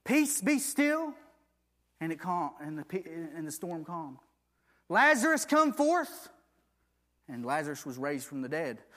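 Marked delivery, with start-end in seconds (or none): 0.0-1.1 high, slow, drawn-out command; commanding Jesus. Acted. Jesus speaking to the storm, slow high command. 4.9-6.3 high, loud call. Acted. Jesus calling dead Lazarus out, loud high call.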